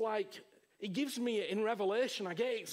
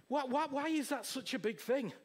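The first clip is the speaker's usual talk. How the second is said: normal talking voice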